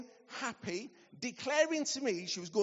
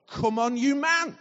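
loud terrace shout, pitched up; football cheer; 6 semitones higher, 12 dB louder than usual. Retold or imitated. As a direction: belt it like a fan in the stands.

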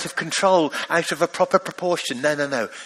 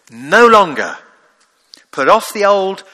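forceful insistence; 10 dB louder than usual. Acted. Preacher voicing Paul's insistence.

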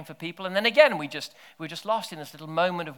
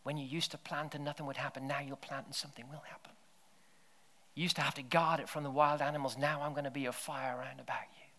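much softer, half-whispered promise; hushed, gentle prophetic promise; soft intimate voice; 8 dB quieter than usual. Pretend. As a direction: murmur it tenderly to them. Continuing soft voice of God.

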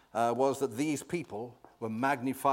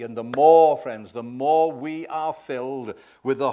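loud emphatic build; 9 dB louder than usual. Acted. Preacher builds to climax.

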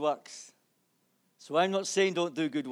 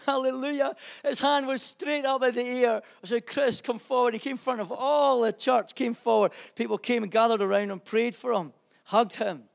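louder, higher, rejoicing testimony; joyful preaching; 7 semitones higher, 8 dB louder than usual. Real, not acted. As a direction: burst out with a jubilant hallelujah.

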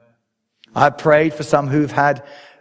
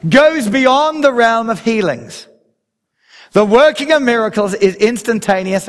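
emphatic preaching; 7 semitones higher, 3 dB louder than usual. Acted.

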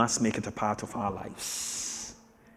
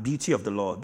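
ordinary delivery

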